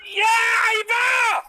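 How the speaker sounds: high, slow, drawn-out shout of shame; shame cry. Real, not acted. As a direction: cry out in reproach, stretched long. Host shames guest mid-quarrel.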